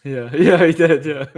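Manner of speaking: loud laughing yeah, yeah; real laughter, loud and breathless; laughing loudly. Real, not acted. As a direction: burst out laughing through the words. Cracking up at chat joke.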